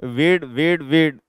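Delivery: "वीड" three times, long ee, no r; pronunciation drill. Shown as a demonstration. Teacher having students repeat it three times, drilled "वीड" with long ee. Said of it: "तीन बार हमें सिर्फ".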